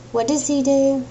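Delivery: usual speaking voice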